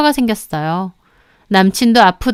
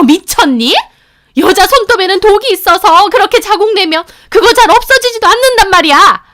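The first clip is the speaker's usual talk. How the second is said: shrill scolding, much higher and louder; screeching mother; 7 semitones higher, 5 dB louder than usual. Retold or imitated. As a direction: shriek at her like a furious mother. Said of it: "악다구니를 쓰며 저에게 따지시는 겁니다".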